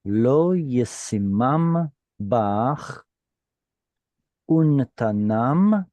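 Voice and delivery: slow, careful Hebrew reading, word by word; slow model reading. Shown as a demonstration. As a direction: read each word out slowly for the class. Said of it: "let me read through it one time".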